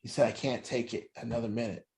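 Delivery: low, very quiet, worn-down plea; tearful father. Retold or imitated. Father breaking down to an addicted child, tearful and hushed. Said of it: "he was crying".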